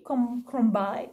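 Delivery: ordinary talk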